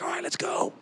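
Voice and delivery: high unvoiced whisper; whispered agreement. Armor bearer whispering his agreement to Jonathan, high and hushed. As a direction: breathe it like a secret yes.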